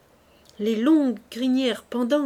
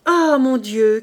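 loud, put-on lament; mock cry of grief; 3 semitones higher, 9 dB louder, slower than usual. Fabrice faking grief to the hussar, loud cry. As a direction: cry out in feigned sorrow. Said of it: "cria-t-il".

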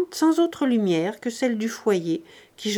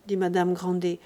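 ordinary delivery

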